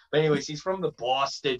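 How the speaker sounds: usual speaking voice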